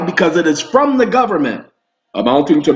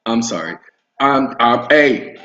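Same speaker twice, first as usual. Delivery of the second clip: ordinary talk, nothing marked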